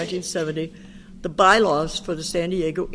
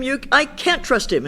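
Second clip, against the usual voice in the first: higher, mock-despairing protest; retold scream; 7 semitones higher, 3 dB louder than usual. Pat screaming back at her sponsor, mild mock yell. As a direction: protest loudly that she can't. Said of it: "And I'd scream".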